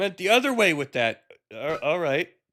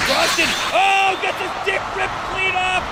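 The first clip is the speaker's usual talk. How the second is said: shocked excited commentary; 12 semitones higher, slower than usual. Real, not acted. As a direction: react like you can't believe your eyes. Shocked commentary on gross fight.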